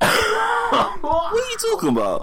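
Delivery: high-pitched incredulous squeal; shocked disbelief. Pretend. Mock disbelief at "fifa and chill.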